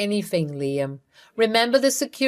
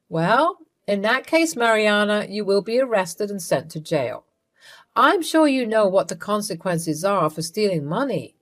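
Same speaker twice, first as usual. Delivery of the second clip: usual speaking voice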